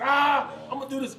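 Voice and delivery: high, loud imitated holler. Ex-player retelling his teammate lifting at a rival, hollering. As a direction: yell it mid-lift as a show-off. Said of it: "hollering and cussing".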